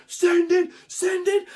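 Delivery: high, pressing acted plea; frantic urging. Bidder egging himself on, frantic urging. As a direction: urge yourself on, high and frantic.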